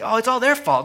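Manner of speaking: high, loud mocking "it's all their fault"; mock blame-shifting. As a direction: toss the blame off lightly. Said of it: "he doesn't say".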